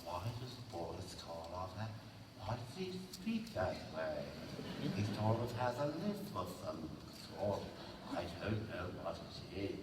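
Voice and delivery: a parent puzzling over Karloff; puzzled parent. Retold or imitated. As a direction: wonder aloud, baffled. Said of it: "parents not happy".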